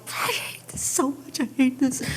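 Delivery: whispered, strained frustration; whispering to herself while driving to work; tense whisper. Retold or imitated. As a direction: mutter it through tight vocal cords. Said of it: "vocal cords would tighten".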